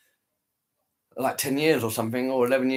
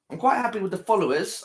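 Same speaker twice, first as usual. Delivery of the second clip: ordinary talk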